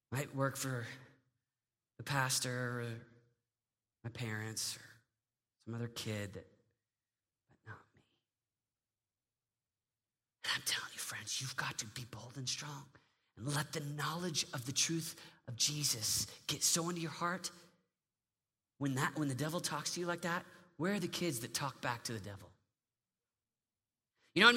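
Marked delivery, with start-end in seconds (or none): 0.1-8.0 very soft low murmur; hopeless whisper. Acted. Teen believing the lie, fading to a whisper. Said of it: "the lie of the enemy". 10.4-22.5 soft, low urgent appeal; hushed preaching. Acted. Preacher softly urging teens to stand strong.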